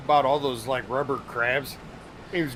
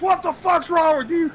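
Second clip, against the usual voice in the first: much higher, louder outrage; mock angry yell; 13 semitones higher, 5 dB louder than usual. Captain yelling at the prankster, mock angry. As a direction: snap at the prankster. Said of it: "he goes".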